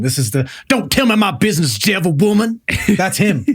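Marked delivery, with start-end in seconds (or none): 0.7-2.6 higher-pitched cranky old man impression; old man from Billy Madison. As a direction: imitate an old man scolding a woman. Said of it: "this is the old man from Billy Madison".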